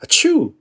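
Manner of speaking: a big comic sneeze. Acted.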